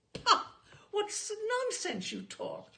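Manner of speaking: loud scoffing outburst, pitched high; exasperated cry. A narrator's reading. Father to mother over the bills, exasperated outburst. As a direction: blow up at her nonsense. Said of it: "Father cried".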